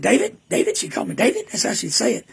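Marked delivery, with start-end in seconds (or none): 0.0-0.8 high, loud mock call of his name; imitating mama calling. Son imitating his mother calling his name. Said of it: "She said, she said".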